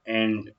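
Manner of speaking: "एंट" said slowly as English ant, open a and crisp t; ant pronunciation. Shown as a demonstration. Teacher demonstrating "एंट" to students as the right sound. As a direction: repeat "एंट" as a clear model word. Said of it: "ऐसे करेंगे हम लोग".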